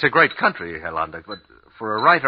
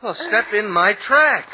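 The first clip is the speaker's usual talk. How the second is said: slow, loud call over the storm wind; raised voice, each word spaced out; 12 semitones higher, 8 dB louder, slower than usual. Acted. Guiding wife through deep snow.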